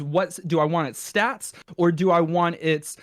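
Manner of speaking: ordinary talk, nothing marked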